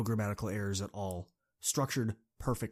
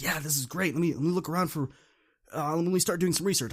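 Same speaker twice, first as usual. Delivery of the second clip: excited; 6 semitones higher, 4 dB louder than usual. Retold or imitated. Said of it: "are just all excited to work".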